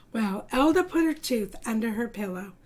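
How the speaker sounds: usual speaking voice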